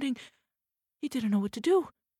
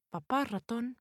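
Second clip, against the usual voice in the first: ordinary delivery, nothing marked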